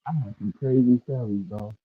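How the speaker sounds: muffled, like through a wall; dull, muffled mic sound. Real, not acted. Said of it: "you muffled".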